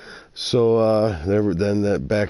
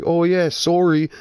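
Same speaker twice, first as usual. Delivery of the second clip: light imitation of a Canadian accent, higher; 9 semitones higher, 3 dB louder than usual. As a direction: lightly mimic a Canadian saying sorry. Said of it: "with the Canadian accent".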